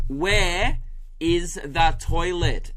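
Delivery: loud, slow, over-separated words; mock tourist yelling. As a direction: spell it out loudly for a non-English speaker. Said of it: "they just start yelling".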